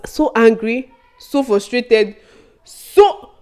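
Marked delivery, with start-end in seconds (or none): 2.6-3.3 "so" pitched very high and loud; emphatic "so". Real, not acted. Storyteller recalling exam frustration, "so" loud and high. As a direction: punch "so" high, then stop. Stressing how angry she was.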